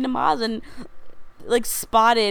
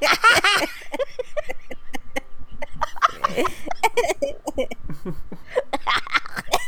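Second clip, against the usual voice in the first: imitated cackle; 3 semitones higher than usual. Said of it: "Summer's normal laugh".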